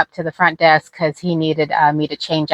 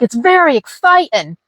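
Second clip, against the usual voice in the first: high-pitched mock Australian; amateur Aussie impression, pitched up; Australian accent; 9 semitones higher than usual. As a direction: gush it in a rough Australian accent. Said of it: "that's the best Australian, Australian accent".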